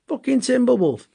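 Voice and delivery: disbelieving Yorkshire driver cursing the Ripper; Yorkshire cabbie swearing. Retold or imitated. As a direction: spit it out in thick Yorkshire. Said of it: "in his thick accent".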